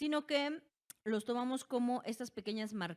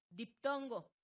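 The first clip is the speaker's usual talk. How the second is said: ordinary delivery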